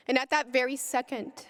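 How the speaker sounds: ordinary delivery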